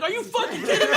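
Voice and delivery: high, laughing mock outrage; retold outburst. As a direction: act out the indignant yell through a laugh. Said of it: "I'm like".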